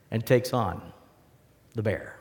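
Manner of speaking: ordinary talk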